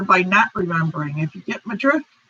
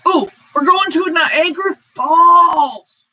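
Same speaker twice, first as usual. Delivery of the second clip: excited announcement; 6 semitones higher, 5 dB louder than usual. Real, not acted. Announcing the virtual vacation spot.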